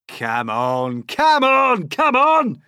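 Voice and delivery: from loud to very high furious yelling; building, impatient centipede bellow; impatient shout building. A narrator's reading. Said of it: "shouted the centipede".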